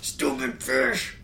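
put-on high, slow whiny kid yelling at the fish; angry little kid. Kid who threw his rod, whining at the fish. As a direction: play a small child throwing a tantrum. Kid rage-quitting at fishing.

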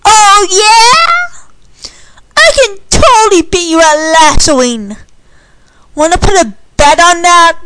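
loud, high-pitched taunt; heated challenge. A narrator's reading. Tom challenging Candy to a lasso bet, heated challenge. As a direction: throw down a dare, loud and high. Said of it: "Tom hissed".

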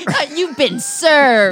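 loud, slow, high mock server; process server. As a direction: slap the papers down. Joking process server bit.

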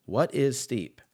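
ordinary delivery

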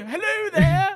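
high, loud mock-posh British hello; mock British accent. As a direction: ham up a fake British greeting, laughing. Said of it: "So she showed up on set like".

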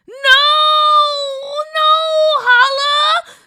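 high, loud anguished yell. Acted. Sister yelling at her brother not to eat, loud anguished yell. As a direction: scream to stop him. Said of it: "Gigi yelled".

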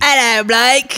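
put-on British accent, big and cheery; mock British accent. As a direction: belt out a cartoonish British greeting. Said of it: "you did a better than I".

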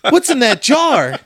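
high, gasping mock excitement; mock excited girlfriend. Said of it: "She was like".

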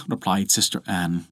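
usual speaking voice

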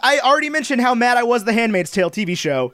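high-pitched, mildly annoyed rant; griping, a little worked up; mildly mad. Real, not acted. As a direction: cut in with a touch of anger. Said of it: "I shouldn't have gotten mad at you".

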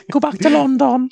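a fed-up king in a silly high voice; Henry the Fourth voice. Pretend. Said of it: "the same Henry the Fourth voice".

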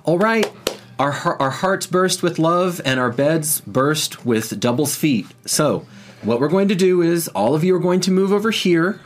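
high, loud voice taking charge of the crowd; senior healer. Acted. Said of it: "the senior healer on duty says".

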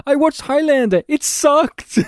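high, hammed-up French accent; sneering French driver voice. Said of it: "in his French accent".

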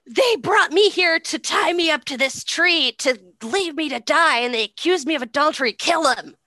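high, loud, hammed-up accusation; hysterical fake victim. Mother accusing her enemies to her sons, hammed-up hysteria. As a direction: wail it out melodramatically to win them over.

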